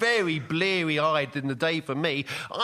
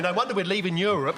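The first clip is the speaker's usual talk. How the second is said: ordinary talk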